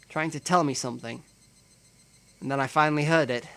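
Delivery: normal talking voice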